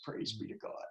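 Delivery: hushed murmured mantra; whispered mantra. Retold or imitated. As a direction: breathe it like a private prayer. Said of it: "She had this mantra".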